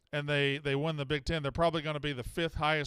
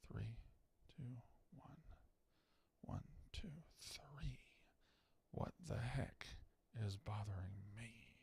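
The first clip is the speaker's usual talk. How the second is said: breathy whisper with no voice at all; whispered mic check; 6 semitones lower, 20 dB quieter, slower than usual. Shown as a demonstration. Host tests the mic, counting in a whisper. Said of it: "mic check counting, whispered".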